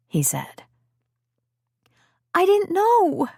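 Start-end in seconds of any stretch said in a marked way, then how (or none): 2.3-3.3 put-on high, pleading young girl voice; a teenage girl insisting she didn't know; young girl Liz.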